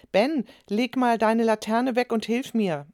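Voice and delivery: a little fed up with her brother; mildly annoyed. A narrator's reading. Sister nags her twin to help, mildly annoyed. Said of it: "sagte Clara leicht genervt".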